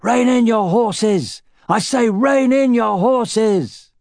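high, slow, drawn-out shout. A narrator's reading. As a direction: halt the riders with a yell. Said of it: "someone shouted".

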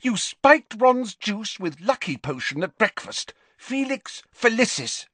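high, accusing voice; shrill. A narrator's reading. Girl accusing friend of spiking juice, shrilly. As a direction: lay out the charge shrilly. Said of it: "said Hermione shrilly".

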